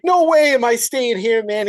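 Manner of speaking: Billy. Retold or imitated. Billy to Carter, refusing to stay, mock little-kid panic. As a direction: imitate a scared kid in a high whine of panic. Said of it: "you can hear the panic".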